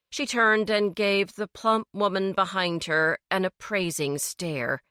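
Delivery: ordinary delivery, nothing marked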